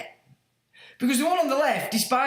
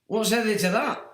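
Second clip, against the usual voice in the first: normal talking voice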